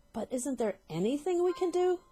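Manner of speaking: high desperate plea; pleading cry. Acted. Wife pleading with husband, high and desperate. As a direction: plead for help. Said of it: "cried Missus Twit".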